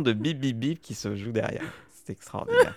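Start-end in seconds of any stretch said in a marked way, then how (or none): 2.0-2.7 mock amazed.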